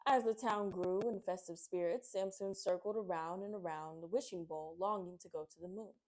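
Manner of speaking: usual speaking voice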